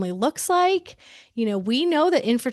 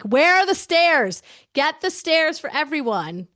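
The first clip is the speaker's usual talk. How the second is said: higher, pushed-out call to a crowd; bullhorn call; 6 semitones higher than usual. Pretend. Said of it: "with a bullhorn that's saying".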